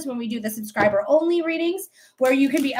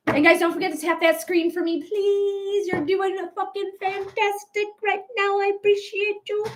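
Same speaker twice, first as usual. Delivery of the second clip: higher, put-on gushing streamer voice; impression of K K Caroline; 7 semitones higher than usual. Fan-thanking streamer imitates K K Caroline, higher put-on voice. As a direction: riff on another streamer thanking her chat. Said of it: "that is my impression".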